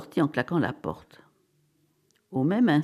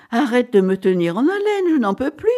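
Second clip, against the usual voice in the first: higher, louder pleading to be told; impatient pleading; 5 semitones higher, 5 dB louder than usual. Acted. Wife begging Cyril to end the suspense, impatient pleading. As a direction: plead as if unable to bear the suspense.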